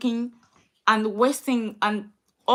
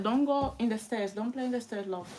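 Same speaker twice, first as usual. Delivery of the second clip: softer, gentle warning to her son; quiet off-camera mum voice; 7 dB quieter than usual. Real, not acted. Mother tells child off.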